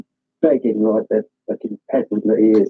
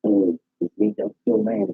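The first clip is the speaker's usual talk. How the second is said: another inflection; 9 semitones higher, 4 dB quieter than usual. Shown as a demonstration. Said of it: "different inflections".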